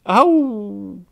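high, loud yelp. Acted. Girl laughing till it hurts, high yelp.

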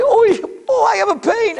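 high pained moan of a man collapsing; mock cry of pain, pitched high.

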